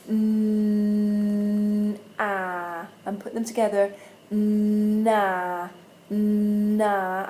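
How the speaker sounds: "n", "ah", then "na" held and drawn out; blending demo. Shown as a demonstration. Teacher modelling blending, slow held sounds. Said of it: "I'm gonna go".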